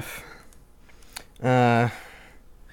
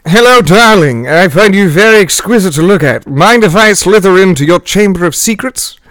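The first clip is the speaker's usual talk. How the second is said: femme fatale; 6 semitones higher, 15 dB louder than usual. Acted. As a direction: purr it out like a loud seducer. Seductive pickup lines in character.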